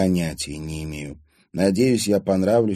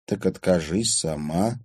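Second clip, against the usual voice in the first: ordinary delivery, nothing marked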